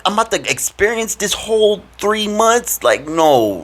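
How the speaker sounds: high, strained begging, rising as it goes; desperate pleading. Retold or imitated. Begging God during boot camp drills, high desperate plea. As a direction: beg God to get you out, voice climbing. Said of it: "I'm telling God, I'm like".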